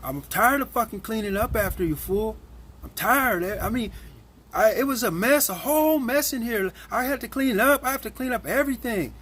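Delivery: high-pitched mocking rant; whiny coworker impression. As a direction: imitate the complaining cook, nagging and whiny. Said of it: "this day he says".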